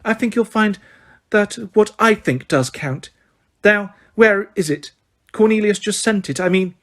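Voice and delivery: high, sugary correction; simpering. Acted. Umbridge correcting McGonagall, simpering. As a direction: simper the name.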